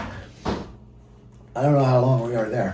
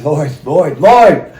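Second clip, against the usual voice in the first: name called, rising to a loud cry; urgent calling out; 9 dB louder, much slower than usual. Retold or imitated. Running down the dock, calling out to Lloyd. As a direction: shout his name to get his attention.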